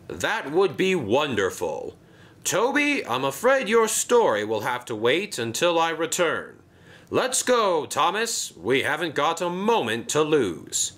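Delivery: urgent, decisive; Sir Topham Hatt. Acted.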